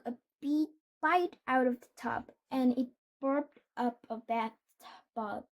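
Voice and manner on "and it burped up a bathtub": usual speaking voice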